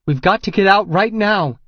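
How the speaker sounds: high, loud panicked scream. A narrator's reading. Regan fighting him in the shower, screaming in panic. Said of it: "she screams at me".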